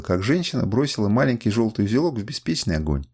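plain reading voice

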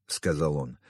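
plain reading voice

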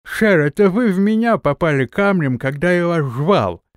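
high, loud nomad voice; the battered nomad asks. A narrator's reading. Nomad voiced, beaten face.

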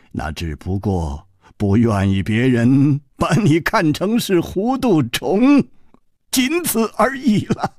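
drawn-out, lilting words of an amused elder; admire, then advise with stretched key words; lightly voiced old master. Old doctor commending the hero and warning the others, amused elder.

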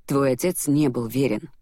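ordinary delivery

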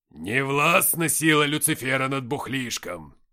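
loud, slow tipsy proclamation; Никита. Acted. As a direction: declaim it grandly, drawing the words out. Nikita's theatrical drunken proclamation. (громкое пьяное воззвание)

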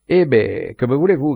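a loud, defiant call; shouted challenge. A narrator's reading. Morin calling to the count on the yacht, loud challenge. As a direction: throw the question out towards the yacht. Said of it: "cria-t-il".

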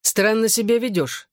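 lightly voiced reproachful father. A narrator's reading. Drunk father rebukes his daughter, reproachfully. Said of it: "с упрёком заявил он".

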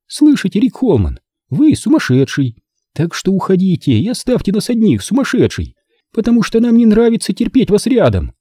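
high, mocking young woman, gleeful; насмешливая Корола. A narrator's reading. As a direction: taunt him in a high teasing voice. Corola mocks Holman. (высоко и насмешливо)

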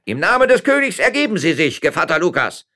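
high, official arrest shout; loud command at full voice. A narrator's reading. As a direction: bellow the order to surrender. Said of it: "schrie der Ortsvorsteher".

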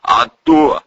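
self-satisfied holler; smug shout. A narrator's reading. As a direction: call it back cockily. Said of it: "самодовольно крикнул в ответ Валерий".

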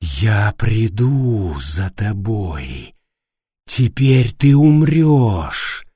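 slow, eerie, drawn-out menace; low, dragged threat from a speaker; creepy voice. A narrator's reading. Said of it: "прервал её жуткий голос".

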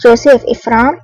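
plain reading voice

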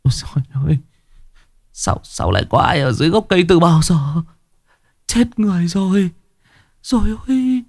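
thin high cry of shock; stammering, stricken lament; horrified wail. A narrator's reading. Man horrified at crushed child below.

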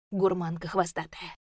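usual speaking voice

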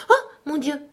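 high, sorry gasp of words; distressed cry. A narrator's reading. Alice sees her blunder about rats, high distressed cry. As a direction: break off and cry out in dismay. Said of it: "s'écria Alice d'un ton chagrin".

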